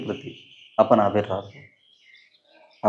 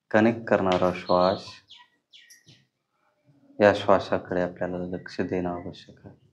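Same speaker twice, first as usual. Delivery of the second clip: calm guiding voice with long pauses; unhurried, even phrases, leaving long silences; guided meditation; 3 semitones lower, 5 dB quieter than usual. Acted. Teacher turns the group's attention to the breath, guided meditation.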